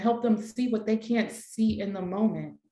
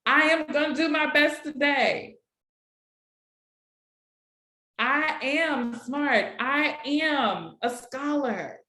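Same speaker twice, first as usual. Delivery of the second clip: slow, higher pupil's affirmations; student affirmations; 5 semitones higher, slower than usual. Shown as a demonstration. Students reciting morning affirmations, slow and a bit higher. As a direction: chant it like a keen student. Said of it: "have your students do some affirmations".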